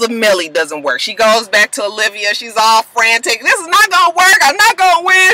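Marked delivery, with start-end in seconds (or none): 3.4-5.3 high, louder whiny complaint; whining. Retold or imitated. Melly whining to Olivia, high and whiny. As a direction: whine it like a spoiled kid. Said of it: "She's whining".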